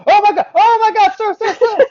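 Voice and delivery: high, loud panicked imitation; mock panic. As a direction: mimic a flustered caller recoiling from a shrill noise. Scammer reacts to shrill noise.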